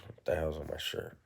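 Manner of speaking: ordinary talk, nothing marked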